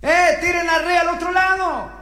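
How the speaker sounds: high-pitched distant call, no extra volume. Acted. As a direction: call across the water to fishermen. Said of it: "quién gritaba". (grito agudo llamando desde lejos)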